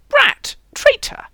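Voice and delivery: loud, high-pitched outburst; outraged. A narrator's reading. Knight rounding on his teasing companion, outraged. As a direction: cry out the insults in outrage.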